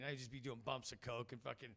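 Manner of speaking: usual speaking voice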